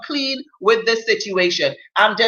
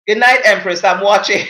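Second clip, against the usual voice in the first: ordinary talk, nothing marked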